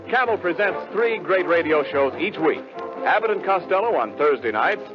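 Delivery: ordinary talk, nothing marked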